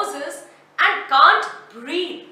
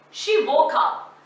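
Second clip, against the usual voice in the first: ordinary delivery, nothing marked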